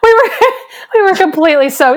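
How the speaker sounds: ordinary delivery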